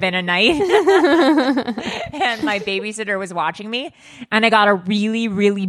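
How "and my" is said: real laughter taking over at the end; laughing. Real, not acted. Podcaster amused at her own slip, laughing. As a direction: giggle through the words, then laugh outright.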